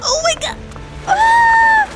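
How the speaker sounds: normal talking voice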